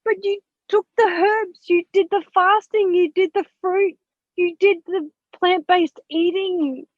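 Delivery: retold exasperated pleading; indignant protest. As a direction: hammer each point in disbelief. Retelling protest at husband.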